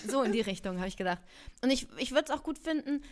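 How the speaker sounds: ordinary talk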